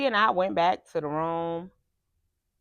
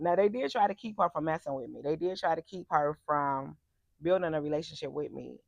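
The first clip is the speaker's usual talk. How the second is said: ordinary talk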